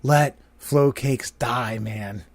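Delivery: slow, hushed quote fading out; Jimbo Rowland. Retold or imitated. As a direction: imitate a friend's earnest plea, softly trailing away. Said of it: "words of our good friend Jimbo Rowland".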